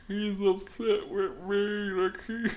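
high, slow whiny sob voice; hammed-up crying; mock crying man. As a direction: imitate a tearful whine. Mocking crying interviewee.

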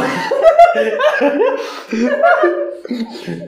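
very high burst of laughter; shrieking laugh. Real, not acted. Laughing at the excuse about his mom, high shrieking laugh.

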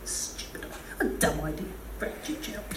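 muttered under the breath, very soft; quiet sulky muttering; grumbling mutter. Retold or imitated. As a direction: grumble it to yourself. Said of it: "I was like".

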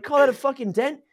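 loud, high-pitched scoffing question; incredulous. Retold or imitated. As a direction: challenge it in high disbelief. Repeats his scoff at the dent.

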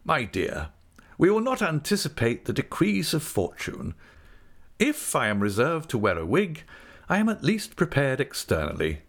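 pompous, showy acceptance of fate; ostentatious resignation. A narrator's reading. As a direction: resign yourself grandly and make a show of it. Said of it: "said with ostentatious resignation".

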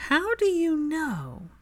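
slow, high incredulous question; high, slow how-do-you-know; startled suspicion. Acted. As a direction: drag it out in disbelief. Hermione caught out, suspicious.

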